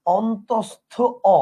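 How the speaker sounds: "অন্তস্থ অ" said slowly in parts, pause before the final "অ"; "অন্তস্থ অ" enunciated piece by piece for learners; name spelled out. Shown as a demonstration. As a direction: set "অ" apart after a clear pause in "অন্তস্থ অ". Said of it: "এটার নাম কি বলেন".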